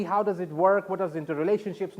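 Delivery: ordinary talk, nothing marked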